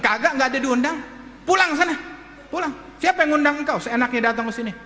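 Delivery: high-pitched, irritated acted rebuff; angry dismissal. Father orders his child's teacher home, angry dismissal. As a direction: send him packing sharply.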